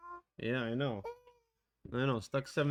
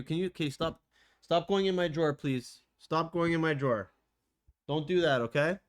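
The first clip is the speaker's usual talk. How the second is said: firm scolding; 5 semitones higher, 4 dB louder than usual. Real, not acted. Telling pet off.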